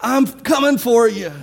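put-on a boy's bold battle cry, called out loud; hollering. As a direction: call out to the giant from far off. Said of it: "they're kind of hollering".

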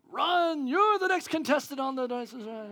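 loud, high game show announcer; game show host. Pretend. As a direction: call them up like a TV host. Game show host calling contestant.

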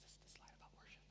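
real hushed, voiceless question; whispered aside. Real, not acted. Preacher checking the worship slide, whispering. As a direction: whisper to a helper off-mic.

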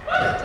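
very high whoop; cheering woo. Real, not acted. Cheering jumpers.

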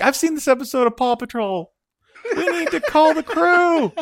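put-on very high kid voice; rally the rescue like a small child; excited child. Voices kid quoting cartoon rescue.

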